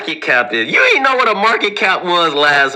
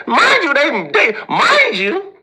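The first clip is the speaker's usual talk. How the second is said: laughing disbelief; 11 semitones higher than usual. Real, not acted. Laughing disbelief at Goldman workers.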